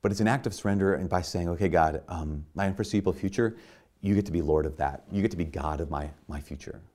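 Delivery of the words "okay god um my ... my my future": soft, lower-pitched prayer, easing off to almost nothing; hushed prayer voice. Acted. Preacher voicing a prayer to God for the congregation, hushed and low. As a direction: pray it quietly, handing the future over.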